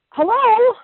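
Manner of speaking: high, loud, mock-incredulous hello; a sharp, sarcastic hello to wake the women up. Preacher jolting her listeners with a loud mocking hello.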